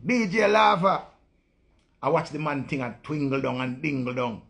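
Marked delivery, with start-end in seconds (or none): 0.0-1.1 much higher, louder, slow hype call; DJ shoutout. Pretend. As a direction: shout it like a dancehall DJ tag.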